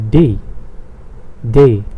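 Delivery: Day said as German "deh"; Day repeated as the German letter D. Shown as a demonstration. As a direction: say Day with a long pure "eh", no glide. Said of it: "Not Monday".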